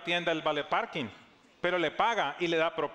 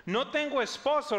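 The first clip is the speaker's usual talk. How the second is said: higher, quick, guarded denial; woman's defensive reply; 9 semitones higher, 3 dB louder than usual. Acted. Samaritan woman answering Jesus, higher and defensive. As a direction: dodge the question. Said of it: "respondió ella".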